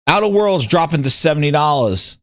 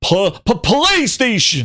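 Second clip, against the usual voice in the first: stuttered start, then slow, high, drawn-out word; Satya, from the chest; 7 semitones higher, slower than usual. Acted. Mimicking the CEO saying PlayStation, from the chest. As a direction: stutter the P, then proclaim it slowly. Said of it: "from the chest".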